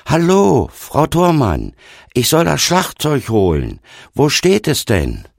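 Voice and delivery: loud, high-pitched call; calling from afar. A narrator's reading. Young man hailing Oma across the yard, calling from afar. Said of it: "rief ihr schon von weitem zu".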